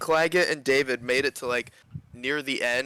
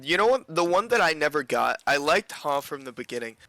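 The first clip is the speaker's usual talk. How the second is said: ordinary delivery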